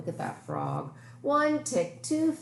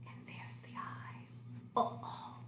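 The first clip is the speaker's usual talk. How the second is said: hushed storytime whisper; suspenseful whisper; 13 dB quieter than usual. Acted. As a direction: whisper it like a secret to the kids. Suspense as the log rises.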